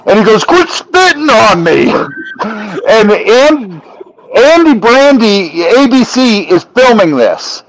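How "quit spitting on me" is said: high, indignant mock yell; imitated angry protest. Imitating Steven spat on mid-argument, high and indignant. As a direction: yelp it as an outraged complaint.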